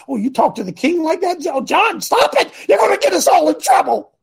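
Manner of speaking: high, panicky mock pleading; hammed-up fretful protest, rising high; mock alarmed companion. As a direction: imitate a nervous friend begging him to stop. Voicing John the Baptist's timid companions.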